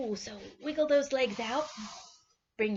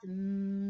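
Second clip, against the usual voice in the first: bee hum. Shown as a demonstration. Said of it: "make your bee sound".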